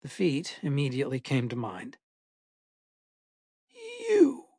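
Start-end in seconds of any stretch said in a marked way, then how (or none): 4.1-4.5 high, eerie creature croak. A narrator's reading. Unseen woman calls from the vent, high eerie croak. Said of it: "the voice croaked".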